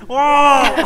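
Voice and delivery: high, loud alarmed "What?"; loud startled what. Shown as a demonstration. Showing how the startled girl should sound, loud alarmed question. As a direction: really jump at the sound. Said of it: "doesn't sound the most intent".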